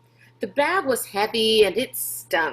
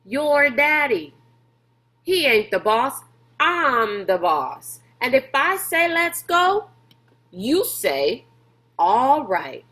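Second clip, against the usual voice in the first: angry boss; 4 semitones higher, 5 dB louder than usual. A narrator's reading. Beauchamp angrily telling Rob he is the boss, louder. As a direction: lay down who's boss, hard. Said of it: "suddenly angry".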